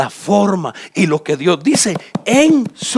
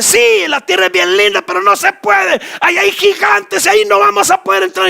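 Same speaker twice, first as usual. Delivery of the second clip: much higher, shouting alarm, slowing on the giants; frightened spies; 12 semitones higher, 4 dB louder than usual. Acted. Spies shout in fear to Moses about the giants. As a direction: cry out that the giants are there.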